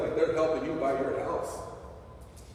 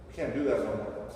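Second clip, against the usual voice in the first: normal talking voice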